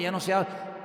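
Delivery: ordinary delivery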